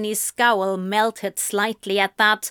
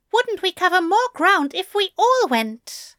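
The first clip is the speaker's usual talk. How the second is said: arguing; 10 semitones higher, 4 dB louder than usual. A narrator's reading. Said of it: "he argued".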